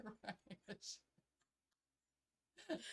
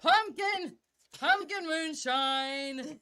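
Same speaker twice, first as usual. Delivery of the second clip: excited announcement; 2 semitones higher, 8 dB louder, slower than usual. Real, not acted. Excitedly announcing her drink.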